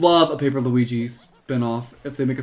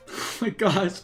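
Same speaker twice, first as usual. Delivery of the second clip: normal talking voice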